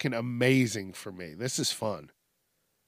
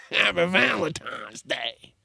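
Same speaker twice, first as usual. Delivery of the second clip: higher, slow, drawn-out holiday greeting; exaggerated greeting; 4 semitones higher than usual. Host mock-celebrating Valentine's Day for listeners, exaggerated greeting. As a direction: stretch out a playful put-on greeting.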